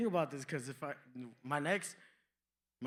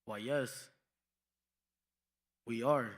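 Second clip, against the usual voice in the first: low, dignified mock-wise voice; lightly voiced solemn elder; 7 semitones lower, slower than usual. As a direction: drop into a grave wise-man voice. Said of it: "I got this".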